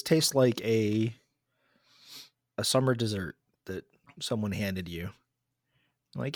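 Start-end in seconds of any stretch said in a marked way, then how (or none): none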